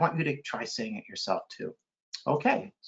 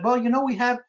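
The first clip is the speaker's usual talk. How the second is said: higher-pitched reported speech; lightly imitated, higher voice; quoted publisher; 8 semitones higher than usual. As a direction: lightly voice a publisher making a suggestion. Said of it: "And my publisher said".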